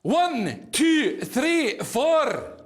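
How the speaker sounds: loud, high Scottish count-in. Shown as a demonstration. Drummer counting in a rowdy crowd, loud Scottish accent. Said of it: "So I'll just demonstrate that for you".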